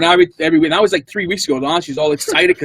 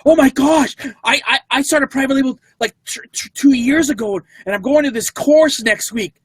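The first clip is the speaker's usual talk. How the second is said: high, gushing excitement; excited lady; 12 semitones higher than usual. Retold or imitated. Lady learning they sell private label, high excited gush. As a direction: pitch her voice way up, thrilled.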